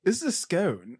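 put-on British voice; British man impression. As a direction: imitate a Brit naming his food. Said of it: "somebody from the U K".

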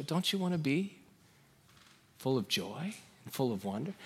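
soft, low fatherly pleading with long pauses; gentle, sorrowful. Acted. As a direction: plead tenderly, as to a hurting son. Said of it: "It'll sound much more like".

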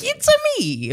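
very high cartoon Italian voice; Mario impression. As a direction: squeak it like Mario. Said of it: "Yeah, like".

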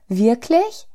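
high, rising hopeful question; surprised cry. A narrator's reading. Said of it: "rief die kleine Seele".

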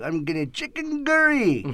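hammed-up mock Indian accent; put-on Indian lilt, jumping high at the end. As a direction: echo him in a comic Indian accent. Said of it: "Or the Indian guy".

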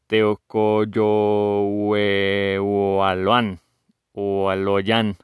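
very slow reread, syllables held; laborious sounding-out. Real, not acted. Host rereading a difficult Náhuatl name, laborious sounding-out. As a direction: feel your way through each syllable. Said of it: "Lo voy a leer otra vez".

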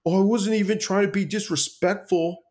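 high, put-on whiny voice; mocking excuse. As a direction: mimic a weak backpedal. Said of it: "he was like".

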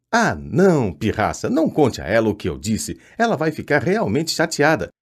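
ordinary delivery, nothing marked

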